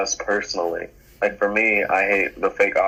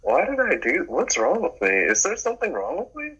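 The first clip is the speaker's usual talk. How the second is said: high, pained self-reproach; exasperated self-questioning; 9 semitones higher than usual. Retold or imitated. A friend recalling lying awake, asking what's wrong with him. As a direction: berate yourself in mock despair.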